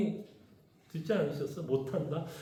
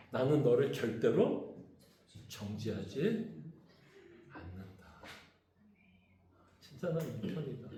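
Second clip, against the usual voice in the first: softer, lower, fading to a hush; tender assurance; 8 semitones lower, 10 dB quieter than usual. Retold or imitated. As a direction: promise it gently, letting it fade. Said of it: "사도 바울식으로 얘기하면".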